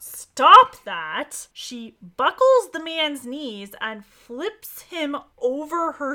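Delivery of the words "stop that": sharp shout, much louder. Acted. George stopping Anton's attack, sharp loud shout. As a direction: yell it to stop an attack. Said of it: "George finally cries out".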